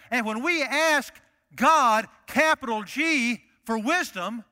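rising to a shouting climax; preaching. Real, not acted.

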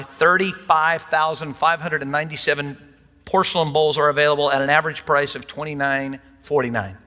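usual speaking voice

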